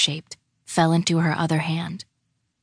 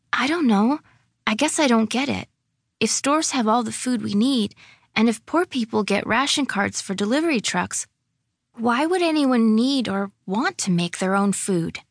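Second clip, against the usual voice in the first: put-on higher-pitched, questioning child voice; puzzled, doubtful girl; 5 semitones higher than usual. Lily tells Claire she doesn't get it, puzzled. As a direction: reason it out like a confused child.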